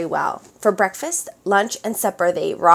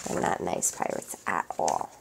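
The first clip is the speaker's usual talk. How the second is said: much lower, whispered aside; 9 dB quieter than usual. Real, not acted. Reader aside about the mean pirates, whispered. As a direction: confide it to the listener. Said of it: "reader's aside".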